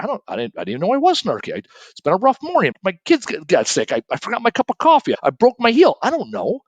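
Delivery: high, rushed, flustered excuses; flustered coworker. Retold or imitated. Sally explaining her snarky mood, flustered and high. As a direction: pour out the bad morning in a rush.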